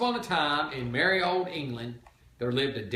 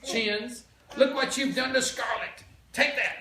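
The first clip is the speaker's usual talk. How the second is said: scolding lady; 9 semitones higher than usual. A narrator's reading. Character scolding the Duke.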